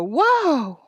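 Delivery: long high cry, falling; drawn-out desperate call; alarmed yell. A narrator's reading. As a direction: holler for the calves to stop. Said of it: "yelled Almanzo".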